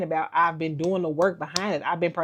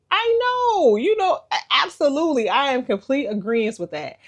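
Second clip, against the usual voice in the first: much higher, eager yes-yes voice; mock eager agreement; 8 semitones higher than usual. Vlogger mocking her eager reply to God, much higher. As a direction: play along brightly, overly agreeable.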